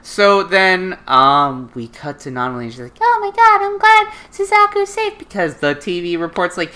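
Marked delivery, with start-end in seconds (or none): 3.0-5.2 put-on very high falsetto girl voice; imitated sweet girl. Nunnally hearing Suzaku's safe, relieved falsetto imitation. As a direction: gush with relief in a put-on girlish pitch. Acted girl's voice.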